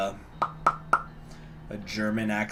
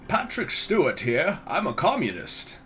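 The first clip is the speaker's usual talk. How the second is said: higher, swooping British actor impression; exaggerated stage-actor voice, pitched up; Patrick Stewart; 5 semitones higher than usual. Shown as a demonstration. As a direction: announce yourself as a theatrical British actor. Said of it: "Maybe, like, a Patrick Stewart".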